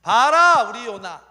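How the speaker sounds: high, booming cry of delight; hammed-up applauding pride; proud, delighted God. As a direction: show off your favorite to everyone. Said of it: "하나님 또 박수 칩니다".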